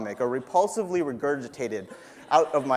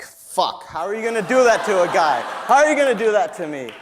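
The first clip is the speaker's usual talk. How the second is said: loud, higher, fed-up complaint; exasperated outburst; 5 semitones higher, 7 dB louder than usual. Retold or imitated. Told he was fighting after Ioka, loud exasperated outburst. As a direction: protest the unfairness out loud. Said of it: "I was just like".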